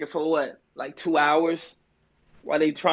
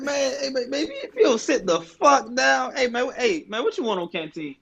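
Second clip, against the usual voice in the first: lightly voiced old-timer; 8 semitones higher than usual. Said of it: "They're like".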